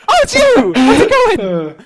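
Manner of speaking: mock delighted surprise. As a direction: greet him like an old friend. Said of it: "I was like".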